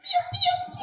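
very high squeaky chick peep; chick cheep. Shown as a demonstration. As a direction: pipe it like a tiny chick. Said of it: "¿cómo dice un pollito?".

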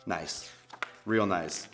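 low, soft, unimpressed; dry sarcasm. Acted. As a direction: mutter it sarcastically to your prankster pal. Reacts to embarrassing words.

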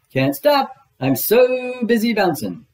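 high, loud, boisterous tiger voice; bouncy Tigger. Acted. Tigger bouncing past, calling loudly to his friends.

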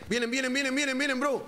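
urgent warning. Real, not acted. Warns enemies coming.

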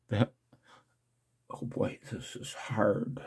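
breathy near-whisper, voice catching; barely getting the words out through real emotion; choked up. Real, not acted. Man looking at his video's views, choked near-whisper. Overwhelmed reading his view counts.

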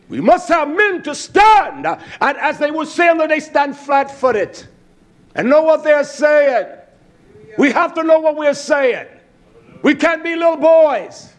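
high, loud preaching cry; fiery sermon delivery; full force preaching. Acted. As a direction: preach it hard to the congregation.

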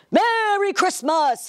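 big booming Santa greeting. Acted. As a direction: boom it out high and loud. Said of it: "he boomed".

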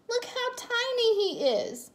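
very high, doting coo; high-pitched motherly cooing. A narrator's reading. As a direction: gush over a tiny puppy. Mommy coos over tiny puppy.

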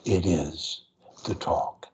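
low voice in small slow chunks; short increments. Shown as a demonstration. Coach modelling easy speech for a stutterer, short slow phrases. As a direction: pause after every few words. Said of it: "as I am doing now".

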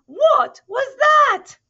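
very high, slow shouted question; startled shout. Acted. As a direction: yell it in amazement at the mirror.

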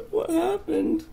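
high-pitched, through laughter; helpless giggly squeal of a question; laughing. Real, not acted. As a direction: ask it while cracking up. Laughing at friend's repeated death.

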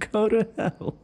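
echoes the insult through a laugh; mock his curse while laughing; laughing mockery. Vampire mocking the hunter's curse, laughing.

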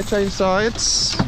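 usual speaking voice

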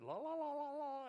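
high, trilling "la la la" imitation of mourners; imitated wailing holler. Preacher showing congregation how Middle Eastern women mourn at funerals, high imitated wail. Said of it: "they're hollering in the street".

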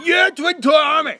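high, excited cheer; rally them up. Real, not acted. Leading a cheer for the kids.

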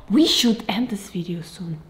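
hushed whisper. Real, not acted. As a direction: whisper it secretly. Whispered aside to dad.

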